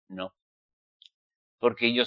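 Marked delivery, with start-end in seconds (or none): none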